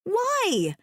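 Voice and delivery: high, drawn-out shocked cry; high yelp demanding a reason. A narrator's reading. Woman shocked by trapped man's confession, yelping. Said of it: "I yelp out".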